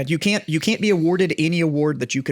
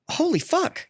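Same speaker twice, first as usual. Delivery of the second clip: shocked swear; 12 semitones higher, 3 dB quieter, slower than usual. Retold or imitated. Retelling shock at the date.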